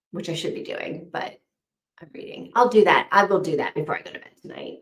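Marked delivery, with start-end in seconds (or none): 0.1-2.5 whispered, voiceless confession; guilty whisper. Real, not acted. Admitting to the chat she's reading instead of working, in a whisper.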